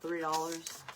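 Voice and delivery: ordinary talk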